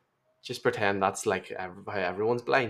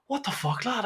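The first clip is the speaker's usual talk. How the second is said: shocked workmate; 11 semitones higher than usual. Retold or imitated. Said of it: "He's like".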